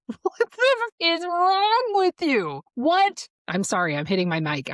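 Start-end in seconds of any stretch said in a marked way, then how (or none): 0.1-3.3 high, loud, drawn-out disbelieving yell; outraged yell. Retold or imitated. Retelling her husband's request, yelling at him in outrage. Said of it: "And I'm like".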